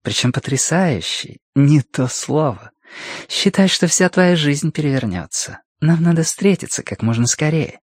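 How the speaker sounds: a hint of a seductive woman on the phone; purring woman. A narrator's reading. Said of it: "промурлыкала она".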